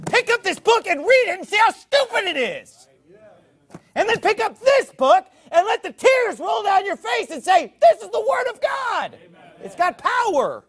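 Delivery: high, loud, shouting exhortation; fiery preaching shout. Real, not acted. Preacher urging Muslims listening online to read the Bible, shouting. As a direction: lift it into a full-throated preacher's cry.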